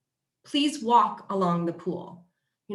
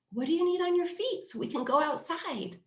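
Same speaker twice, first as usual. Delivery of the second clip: bright asking mom voice; 8 semitones higher, 4 dB quieter than usual. Retold or imitated. Mom asks daughter about shoes.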